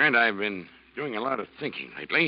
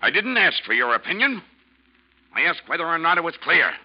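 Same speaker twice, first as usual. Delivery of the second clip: loud, higher, cutting reply; sharp rebuke; 10 semitones higher, 9 dB louder than usual. Acted. Captain snapping at the insolent helmsman. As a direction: put him in his place.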